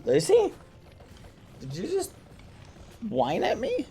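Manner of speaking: high surprised voice to the pet. Real, not acted. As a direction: squeak it out, amazed she whined.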